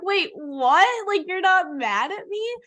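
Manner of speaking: tearful daughter amazed parents aren't mad; stunned disbelief. Retold or imitated. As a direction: replay the shock of not being scolded. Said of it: "then I'm crying 'cause I'm like".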